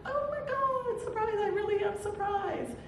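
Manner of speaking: high, delighted squeal of surprise; mock surprise. Nancy at her surprise party, squealing in high delight. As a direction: play the surprised birthday girl. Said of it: "She's like".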